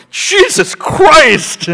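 high, loud, drawn-out exclamation; mock-shocked paramedic crying out in disbelief; horrified outcry.

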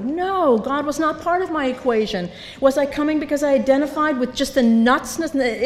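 ordinary talk, nothing marked

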